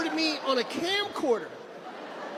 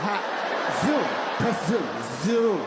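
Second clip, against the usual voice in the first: lower, lightly voiced camera operator; zooming dad; 10 semitones lower than usual. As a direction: press zoom like a parent filming. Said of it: "acting the camcorder user".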